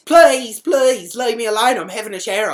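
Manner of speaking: loud, high begging; distressed pleading. Retold or imitated. Housemate begging from behind the bathroom door, loud pleading. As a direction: wail it through a closed door.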